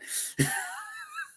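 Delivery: very high, wailing laugh; half laugh, half whine; squealing laugh. Real, not acted. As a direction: let out a high crying laugh. Reaction to Bronco Country.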